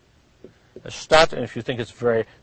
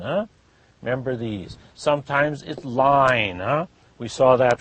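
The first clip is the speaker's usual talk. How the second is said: usual speaking voice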